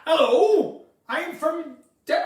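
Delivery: high, loud West Country accent; Devon farmer accent. Shown as a demonstration. Trying Devon from farmer land, West Country accent. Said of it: "like, right like that".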